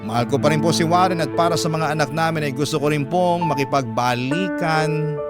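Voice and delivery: ordinary talk, nothing marked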